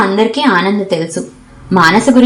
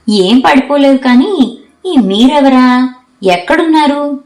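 lightly voiced girl, higher and teasing; cheeky roommate; 6 semitones higher than usual. A narrator's reading. Ananda teasing shy Manasa, higher playful voice. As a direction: play the bubbly chatterbox poking fun.

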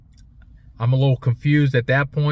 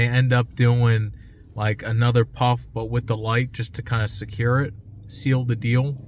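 talking around cigar; cigar in mouth. Real, not acted. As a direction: lips on cigar. Seen 0: Cigar held at lips from 1.6s while talking, then lit.

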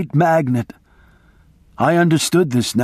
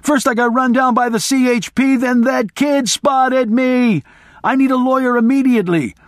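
frantic shouting; 10 semitones higher than usual. Retold or imitated. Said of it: "I screamed".

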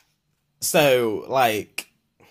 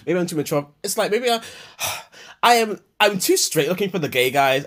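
normal talking voice

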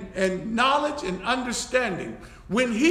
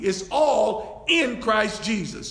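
ordinary talk

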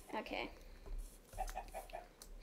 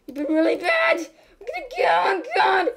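higher, louder playful scolding breaking into laughter; mock scolding shout; 8 semitones higher, 8 dB louder than usual. Scolding a naughty game animal, laughing mock shout. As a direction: build it to a laughing yell.

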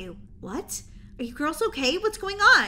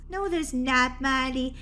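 usual speaking voice

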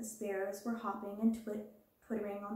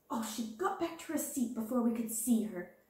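exclaimed. A narrator's reading. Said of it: "Sarah exclaimed".

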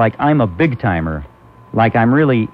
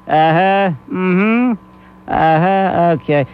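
mock impatient acknowledgements; short, put-on grunts of waning patience; imitating irritated host; 3 semitones higher, much slower than usual. Imitating the host losing patience with a caller, mildly irritated. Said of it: "you picture him getting irritated".

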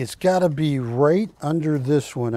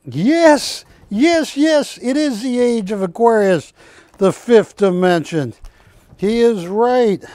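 louder, higher, triumphant yes yes; excited find; 5 semitones higher, 6 dB louder than usual. Real, not acted. Finding the Age of Aquarius print, excited shout. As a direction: celebrate the discovery.

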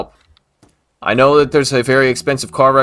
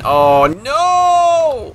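very high, long-held wail of "no"; dismayed scream; 4 dB louder than usual. Real, not acted. Gamer watching the truck meet disaster at the dock, dismayed scream. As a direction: scream it out as everything goes wrong.

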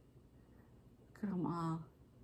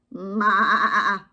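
loud, wobbling goat maa; goat bleat; 7 dB louder than usual. A narrator's reading. Goat answering Duck as he passes, goat bleat. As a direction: bleat loudly with a quaver. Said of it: "said the goat".

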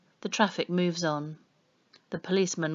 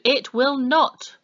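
slow, loud, each word hit; emphatic refusal; 8 semitones higher, 8 dB louder, slower than usual. A narrator's reading. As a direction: spell it out with menace. Policeman scolding the pair.

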